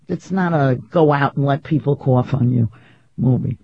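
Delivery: usual speaking voice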